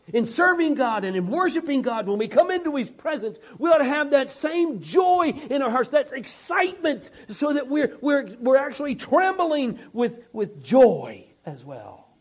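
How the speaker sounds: full-voiced pulpit exhortation, dropping on "as well"; impassioned preaching. Real, not acted. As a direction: preach it up with rising fervour.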